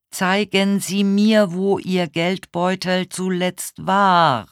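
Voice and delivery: high, slow, even delivery; over-enunciate for someone hard of hearing; loud and clear. A narrator's reading. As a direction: slowly project each word. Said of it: "besonders laut und besonders deutlich auszusprechen".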